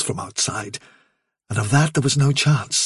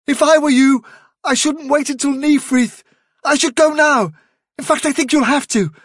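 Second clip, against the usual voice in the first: high-pitched rabbit giving pressing advice; urgent remembered advisor; 12 semitones higher than usual. A narrator's reading. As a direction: press a friend to leave, pitched well up.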